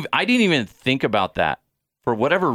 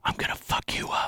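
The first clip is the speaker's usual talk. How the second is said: hushed threat in the ear; whispered; 7 dB quieter than usual. Retold or imitated. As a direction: whisper it right into his ear. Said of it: "he whispered that in my ear".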